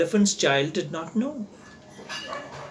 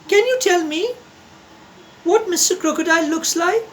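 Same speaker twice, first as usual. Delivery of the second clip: put-on high, quick curious child voice; bubbling with questions; little elephant's voice; 10 semitones higher, faster than usual. A narrator's reading. Elephant's child asks the crocodile, high and eager.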